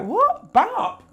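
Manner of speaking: very high, incredulous squeak; mock disbelief. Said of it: "So man was like".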